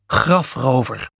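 ordinary delivery

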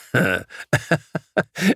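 diepe bulderende lach; bulderlach. A narrator's reading. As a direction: barst uit in bulderend gelach. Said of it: "barstte uit in een diep en bulderend gelach". (diep, bulderend gelach)

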